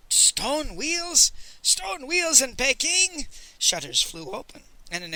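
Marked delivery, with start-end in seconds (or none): 0.1-3.3 high, incredulous rasping cry; rasping exclamation. A narrator's reading. Miser inside the house exclaiming at stone wheels, rasping. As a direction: exclaim it high and drawn out, with a rasp. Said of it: "a rasping voice exclaimed".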